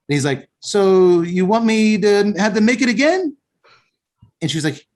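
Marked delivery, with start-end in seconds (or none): 0.6-3.4 lightly voiced counter guy, higher; little Italian guy. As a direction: pitch it up for a tentative question. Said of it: "the little Italian guy's like".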